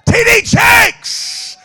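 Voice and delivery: high, slow, booming name announcement; hammed-up hype shout; excited shout. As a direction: call the name out like a star's arrival. Announcing famous preacher's name.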